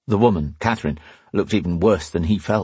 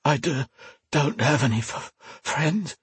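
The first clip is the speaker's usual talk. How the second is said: softer, broken gasps between words; gasped, stammering; 7 semitones higher, 5 dB quieter than usual. A narrator's reading. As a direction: force each word out through pain. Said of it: "Alesh gasped".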